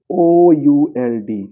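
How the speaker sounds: O U L D spelled very slowly and loudly. Shown as a demonstration. Teacher spelling the word letter by letter, loudly. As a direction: stretch each letter of O U L D. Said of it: "spelling out slowly".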